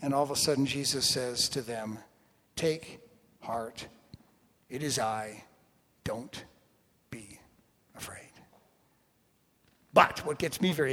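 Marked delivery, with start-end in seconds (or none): 2.6-8.3 slow, low, spaced word by word, fading to a hush; calm reassurance. Acted. Jesus speaking to terrified disciples, calm and reassuring. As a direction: let each word settle, softer and softer.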